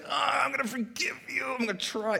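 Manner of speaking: strained, high-pitched mock effort; forced forgiveness. Preacher mimicking someone trying to forgive by willpower, straining.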